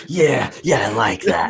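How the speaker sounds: mock excitement, playing a lusty ghost; aroused ghost. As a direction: act out an over-eager ghost. Acting an aroused ghost running at someone.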